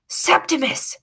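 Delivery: hissed name. A narrator's reading. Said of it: "hisses the boy to the rat".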